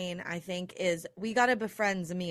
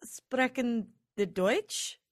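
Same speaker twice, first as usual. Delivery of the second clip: mock German; much slower than usual. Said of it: "And I just go".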